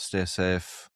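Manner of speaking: ordinary talk, nothing marked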